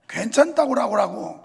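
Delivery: loud, singsong Jeolla reply; Jeolla dialect. Retold or imitated. Preacher imitates a Jeolla speaker declining, Jeolla accent. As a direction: rattle it off like a Jeolla local. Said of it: "전라도는".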